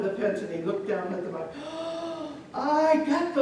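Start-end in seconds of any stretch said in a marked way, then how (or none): 1.6-2.5 sharp drawn-in gasp; startled gasp. Acted. Boy looking down at the cat, startled gasp. 2.5-3.4 high, loud startled cry; alarmed cry. Acted. Little boy in the story spots a cat, alarmed cry.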